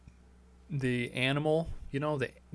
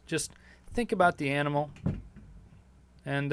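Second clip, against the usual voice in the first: ordinary talk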